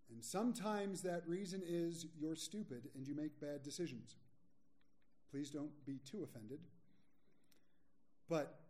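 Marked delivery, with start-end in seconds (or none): none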